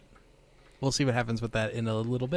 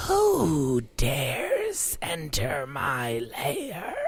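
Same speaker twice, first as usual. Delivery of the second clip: slow, drawn-out lair voice; ominous hidden creature speaking; unearthly voice; 2 semitones higher, much slower than usual. Acted. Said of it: "the pair of you hear a voice".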